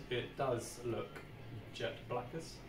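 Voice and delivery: ordinary delivery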